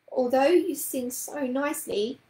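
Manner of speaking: plain reading voice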